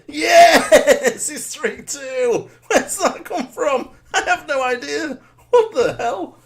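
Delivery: high, loud, laughing yells of disbelief; real delighted goal celebration; ecstatic laughing cheer. Real, not acted. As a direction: scream with joy and laugh through it. Second quick goal scored.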